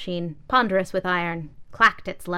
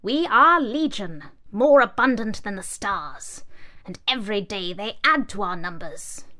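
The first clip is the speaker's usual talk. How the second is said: ominous umbrella; 3 semitones higher, 5 dB louder than usual. Acted. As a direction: proclaim it like a horde. Umbrella boasts of numbers.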